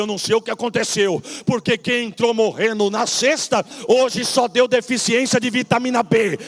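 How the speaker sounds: loud, high, fast-rushing retelling of the good news; excited retold call. Pastor retelling husband's miracle news to church, loud and excited. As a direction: shout the miracle report as the husband did.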